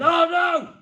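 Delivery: high, loud hammed-up dismay; mock dismay. Comedian wailing that Santa is out, high and loud. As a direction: wail your disappointment. Comic disappointment.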